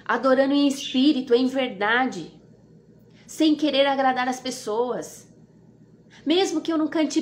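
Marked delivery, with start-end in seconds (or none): none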